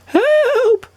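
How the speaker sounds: high, loud shriek of a magic wand; high shrill shriek. A narrator's reading. Magic wand shrieking for help, high and loud. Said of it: "shrieked the wand in a high, shrill voice".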